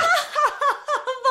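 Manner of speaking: a sharp, high mocking laugh; high scoffing laugh. Mocking the doctor's silly answer with a high laugh. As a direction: burst out laughing at the nonsense.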